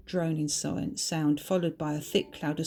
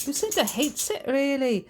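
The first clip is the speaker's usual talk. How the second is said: put-on Scottish accent; 8 semitones higher, 3 dB louder than usual. Bertha teases about her sister.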